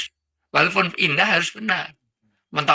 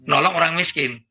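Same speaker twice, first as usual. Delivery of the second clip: ordinary talk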